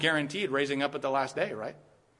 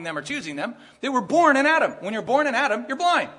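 much higher and louder, hammering; fiery preaching; 6 semitones higher, 6 dB louder than usual. Acted. Pastor answering his own question, fiery preaching. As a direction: thunder it from the pulpit.

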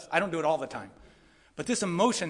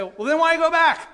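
much higher and louder, a mock baffled outcry; incredulous question; 11 semitones higher, 11 dB louder than usual. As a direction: blurt it out as the puzzled crowd. Voicing the listeners' objection.

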